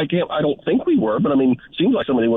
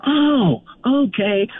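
dumb realization; 10 semitones higher, much slower than usual. Retold or imitated. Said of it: "He goes".

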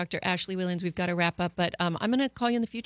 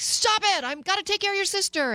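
high-pitched exasperated mom yell, rushed; retold yelling; 12 semitones higher, 4 dB louder than usual. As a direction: re-enact a harried parent snapping loudly at a child. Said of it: "I was just yelling at the other one".

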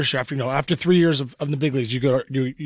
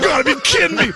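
exasperated shout; 10 semitones higher than usual. Retold or imitated. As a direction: throw up your hands in mock despair. Exasperated outburst.

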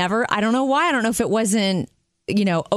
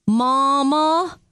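put-on higher little-girl North Carolina drawl; North Carolina accent; 5 semitones higher than usual. Aunt recalls her little niece calling mom, imitated North Carolina accent. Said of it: "she picked up the North Carolina accent".

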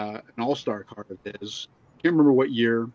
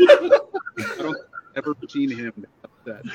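laughing; 3 semitones higher, 6 dB louder, slower than usual. Real, not acted. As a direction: crack up while talking. Laughing while telling story.

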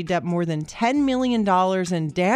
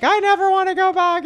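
put-on whiny kid voice; 10 semitones higher than usual. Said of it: "One's like".

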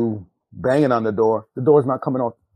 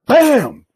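loud, high-pitched exclaimed "bam"; shouted impact sound; sudden slam "bam"; 14 semitones higher, 8 dB louder than usual. Retold or imitated. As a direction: punch out a sudden, explosive bam. Gun suddenly slammed to head.

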